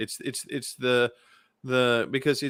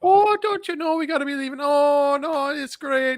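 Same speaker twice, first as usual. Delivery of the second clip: much higher, fussy Midwestern mom brushing off the fuss; 14 semitones higher, 4 dB louder than usual. Retold or imitated. Cohost imitates a Midwestern mom's long goodbye, high and fussy. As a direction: play a polite Midwestern mom insisting it's fine, pitched way up. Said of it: "it's my Midwestern mom".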